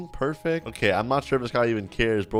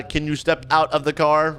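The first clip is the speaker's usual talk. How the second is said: usual speaking voice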